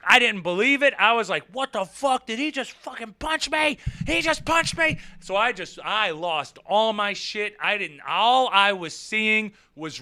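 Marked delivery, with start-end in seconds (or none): 1.5-5.0 high-pitched mock outrage; incredulous, high retold outburst; outraged disbelief. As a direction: act out total shock at the hit, voice way up. Acting out shock at being punched.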